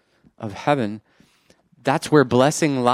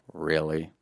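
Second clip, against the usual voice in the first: low, quiet deadpan reply; unimpressed God; 8 semitones lower, 9 dB quieter than usual. Retold or imitated. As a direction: drop right down, softly doubting. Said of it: "And he's just like".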